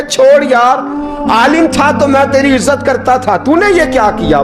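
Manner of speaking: high, reproachful scolding; quarrelling elder. Retold or imitated. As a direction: berate a once-respected scholar. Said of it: "झगड़ने लगे". (ऊँची आवाज़ में नाराज़ बुज़ुर्ग की झिड़की)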